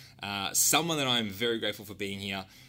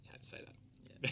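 whispered; 11 dB quieter than usual. Real, not acted. Teasing aside after guest's answer.

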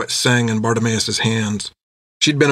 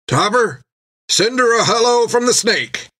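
calling out; 11 semitones higher than usual. Acted. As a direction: shout the order below. Jack calls to gunner.

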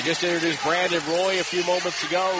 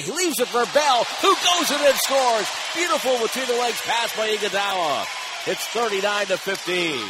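rising, faster excited radio call; excited play-by-play; 6 semitones higher, 3 dB louder than usual. Acted. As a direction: build the call up to the basket.

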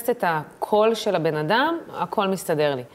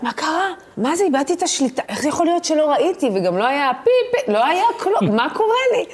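high-pitched, bewildered panic; rattled questions rising into a comic panic; retold panic; 8 semitones higher than usual. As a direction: reenact frantic disbelief, pitch climbing. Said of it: "אני בכזאת פאניקה".

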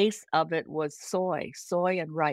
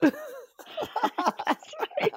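high, laughing agreement. Real, not acted. Laughing as a friend recalls [name]'s favorite product, high and laughing. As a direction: laugh through the words.

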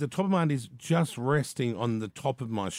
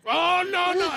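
high, loud alarmed "Oh, no, no"; nurse freaking out; 4 dB louder than usual. Retold or imitated. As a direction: squeal it like a startled nurse. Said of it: "She's like".

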